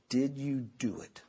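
quiet, low, measured question; stern father. Retold or imitated. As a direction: ask with ominous restraint. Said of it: "And then he would say".

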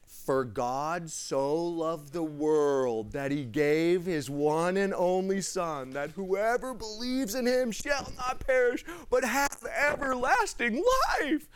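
slow, rising, awed reading of the verse; grand recitation. Retold or imitated. Zane reciting John three sixteen to friends with awe. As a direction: deliver it like a big reveal.

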